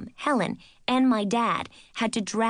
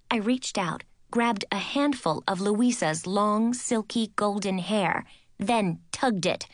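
ordinary delivery

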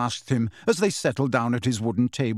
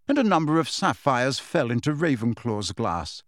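ordinary delivery, nothing marked

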